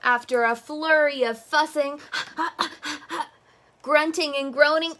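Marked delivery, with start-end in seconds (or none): 2.1-3.3 gasp. Acted. Fussing scene.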